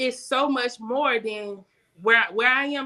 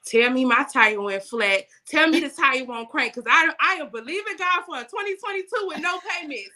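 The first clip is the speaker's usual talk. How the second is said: ordinary talk, nothing marked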